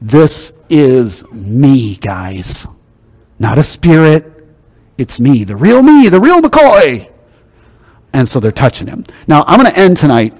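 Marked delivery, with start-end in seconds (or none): 0.0-7.1 slow, deliberate insistence, climbing on real McCoy; Jesus insisting to the disciples, slow and emphatic. Retold or imitated. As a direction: lean on every word to convince. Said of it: "Jesus is being very emphatic".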